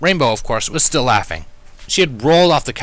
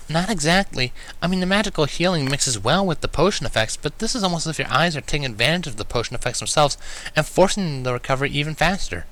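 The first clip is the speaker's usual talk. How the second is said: a touch higher, earnest curious mare; lightly voiced Twilight; 7 semitones higher than usual. A narrator's reading. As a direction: explain it like a fascinated young scholar.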